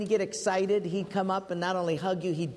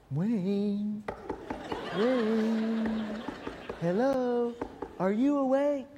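higher sing-song call of a grinning roommate; calling through door; 4 semitones higher, much slower than usual. Retold or imitated. Roommate Mike at one in the morning calling Wayne, sing-song. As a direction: coax him awake through the door.

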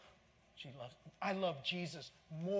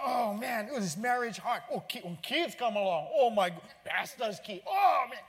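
voicing worries; 6 semitones higher, 10 dB louder than usual. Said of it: "those voices can keep going".

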